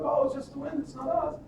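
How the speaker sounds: high-pitched innocent denial; children's voices. Retold or imitated. Children cover up to the old lady, in high innocent voices. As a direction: pitch it way up as the kids playing innocent.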